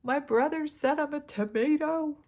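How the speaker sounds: high little-girl voice, mock upset; imitating tearful daughter. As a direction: play the daughter whining about her brother, high. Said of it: "she was in tears".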